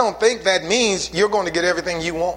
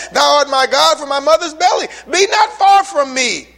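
fervent plea; 9 semitones higher, 7 dB louder than usual. Acted. Preaching psalm at full force.

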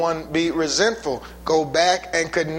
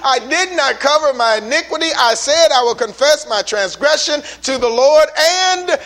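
higher and louder scripture proclamation; full-force preaching; 5 semitones higher, 4 dB louder than usual. Real, not acted. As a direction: proclaim the verses, climbing.

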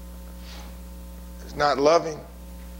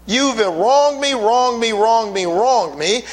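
aggrieved accusation; 3 semitones higher, 3 dB louder than usual. Acted. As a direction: hammer "wronged" over and over, letting it sink. Preacher acts aggrieved accuser.

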